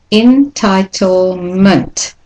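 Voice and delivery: "entitlement" very slow, en-ti-tle-ment; slow-motion model of "entitlement"; syllable-by-syllable demonstration. Shown as a demonstration. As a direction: stretch each syllable of "entitlement" apart. Teacher breaks word into syllables.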